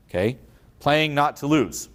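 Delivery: ordinary talk, nothing marked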